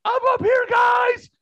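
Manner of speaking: high, loud shout across the theater; shouted call. Retold or imitated. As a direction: yell it up from the top row. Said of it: "And she goes".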